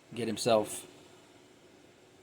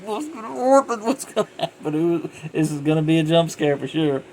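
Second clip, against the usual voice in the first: high, loud squeal of dread; bracing for a jump scare, pitched way up; hammed-up nervous anticipation; mock panicked viewer; 6 semitones higher, 6 dB louder than usual. Voicing tense viewer before jump scare.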